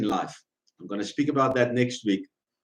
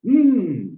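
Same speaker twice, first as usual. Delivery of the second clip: agreeing hmm; 8 semitones higher, 5 dB louder than usual. Shown as a demonstration. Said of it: "or say".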